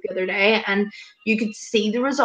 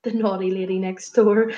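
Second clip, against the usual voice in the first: usual speaking voice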